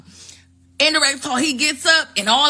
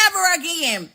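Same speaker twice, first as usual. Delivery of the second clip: emphatic falling answer, mildly raised pitch; firm confirmation; 5 semitones higher than usual. Acted. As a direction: shut the question down with a falling tune. Other side answers, confirming it.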